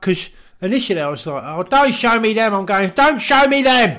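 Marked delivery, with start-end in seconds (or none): 1.5-4.0 high, loud pleading cry; mock pleading yelp. Friend crying out at Elton not to show them. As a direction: plead louder on the repeat.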